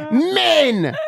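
high, loud mock cry of exasperation; disgusted exclamation. Daughter condemns dad and all men, imitated outraged shout. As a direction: throw it out as an accusation.

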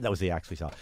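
usual speaking voice

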